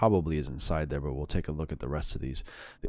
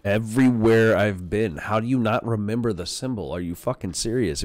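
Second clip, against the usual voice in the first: loud, higher-pitched exasperated retort; exasperated outburst; 5 semitones higher, 7 dB louder than usual. Real, not acted. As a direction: blurt it out loudly in disbelief. Exasperated reply to game character's question.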